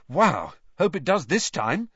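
bright, keen exclamation; enthusiastic. Acted. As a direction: say it with real excitement. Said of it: "said Harry enthusiastically".

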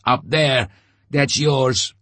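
high, loud urgent cry; shouting to Letyaga over the crowd. A narrator's reading. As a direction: jab the words upward at him. Artyom urges Letyaga, pointing up.

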